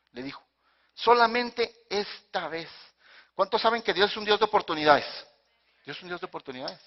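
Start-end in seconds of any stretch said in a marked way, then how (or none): 0.9-2.7 high, very slow plea; pleading. Acted. Samson asks God just this once, drawn-out begging. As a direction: stretch each word out in supplication.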